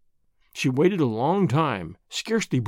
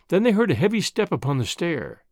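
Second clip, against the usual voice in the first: usual speaking voice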